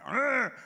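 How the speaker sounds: loud high startled roar; dad startled awake by the yelling, loud growl; waking growl. Retold or imitated. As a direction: jolt awake with a big growl. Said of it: "I'd wake up with that".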